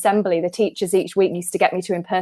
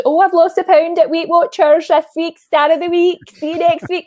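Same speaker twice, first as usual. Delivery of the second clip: put-on high, loud, chatty Scottish accent; put-on Scottish voice, pitched well up; Scottish mum impression; 9 semitones higher, 4 dB louder than usual. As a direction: mimic a proud Scottish mother. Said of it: "had a conversation how my mum had lost a pound".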